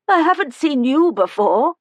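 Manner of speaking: put-on a tiny old woman calling from the doorway; high-pitched old woman. A narrator's reading.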